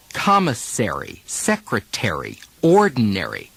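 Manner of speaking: full American -ary endings, stress on the R syllable. Shown as a demonstration. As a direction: give each word the American -ary ending. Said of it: "Americans stress the syllable containing the R".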